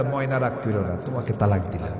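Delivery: soft, low sweet-talk; mock loving. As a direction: imitate a husband gently coaxing his wife. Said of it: "কেউ কি মহব্বত করে তালাক দেয়".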